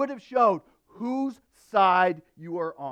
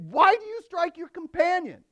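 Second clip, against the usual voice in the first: sharp, much higher, louder rebuke; scolding; 12 semitones higher, 7 dB louder than usual. Retold or imitated. Moses rebukes the Hebrew striking his companion, sharp high scolding. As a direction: scold him like a stern judge. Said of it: "scolded the first one".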